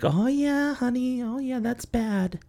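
high, slow, soft cooing nurse; sympathetic nurse. Retold or imitated. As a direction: coo it like a sorry nurse. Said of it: "she's like".

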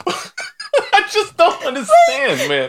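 can't stop laughing at the foot; laughing disbelief. Real, not acted.

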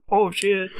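high startled mock yelp; sudden panicked gasp of a curse; caught off guard. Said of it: "and I'm like".

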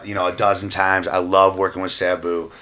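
ordinary talk, nothing marked

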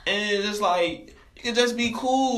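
high-pitched, animated appeal with a laugh; pleading protest. Retold or imitated. Pleads against being yelled at.

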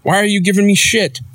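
hurt fan. Retold or imitated. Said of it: "And it's like".

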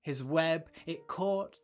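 gentle storyteller voice telling a fable; story narration. A narrator's reading. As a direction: read it like a bedtime tale for listeners. Storyteller tells children's fable.

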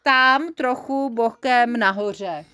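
ordinary delivery

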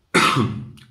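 sharp dry cough; coughing fit. Real, not acted. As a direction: cough hard mid-sentence. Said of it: "guys, I'm coughing".

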